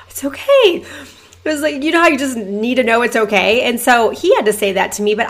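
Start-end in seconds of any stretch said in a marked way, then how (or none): 0.0-0.8 relieved gasp. Retold or imitated. Relief after husband reassures her.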